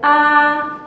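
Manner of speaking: "Ah" held high and level; first tone. Shown as a demonstration. Teacher showing learners the tone, "Ah" high and level. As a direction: sustain "Ah" on one high note. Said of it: "yang penting datar aja".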